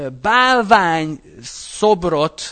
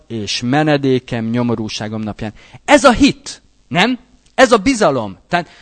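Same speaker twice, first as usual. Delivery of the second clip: usual speaking voice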